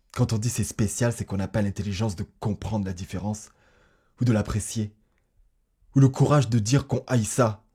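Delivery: heated and emphatic, climbing to the end; indignant outburst. Acted. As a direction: build to a loud, exasperated protest. Indignant rant in a confession.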